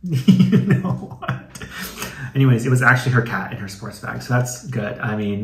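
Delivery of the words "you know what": slow, laughing repeat; can't stop laughing as you say it; giggling, drawn out; laughing through it. Real, not acted. Laughing at own misreading.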